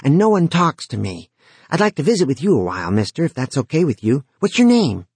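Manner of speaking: put-on bright, lonely young boy; eager teen boy voice. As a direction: talk like a lonely chatty kid. Dead teen Jory chatting.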